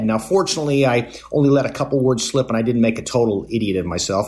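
normal talking voice

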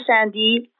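ordinary talk

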